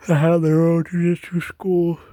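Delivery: words stretched by a yawn. Real, not acted. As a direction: talk through a big yawn. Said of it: "Sorry, I yawned".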